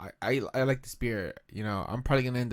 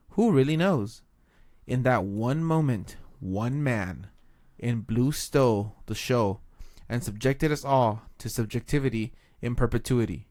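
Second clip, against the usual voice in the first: dramatic narration; 5 dB louder than usual. Acted. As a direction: read it like an epic. Reads beer label dramatically.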